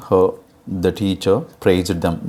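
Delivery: normal talking voice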